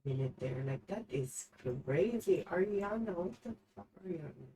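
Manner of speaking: ranting under her breath; trying to calm down. Real, not acted. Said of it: "I gotta calm down for a second".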